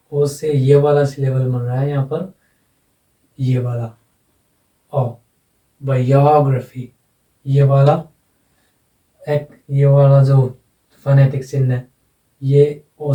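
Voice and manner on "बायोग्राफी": "बायोग्राफी" as bai-YOOG-ruh-fee, long stressed "यो". Shown as a demonstration. Teacher points out the accented syllable, stress on YO. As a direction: hold and punch the "यो" in "बायोग्राफी". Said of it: "तो ये accent लगेगा यहाँ पर".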